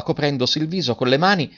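ordinary delivery, nothing marked

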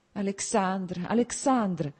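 high, pleading call of a name; agitated, tearful murmur. A narrator's reading. Princess to her angry husband, agitated and tearful. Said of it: "mormorava, agitandosi e scoppiando in pianto".

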